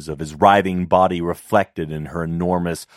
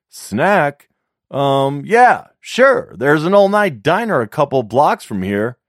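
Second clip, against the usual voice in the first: much higher, louder chatty voice; eager flirting man; 8 semitones higher, 6 dB louder than usual.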